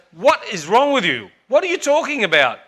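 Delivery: mock-stern mum scolding; annoyed mother. Mother scolds her daughter.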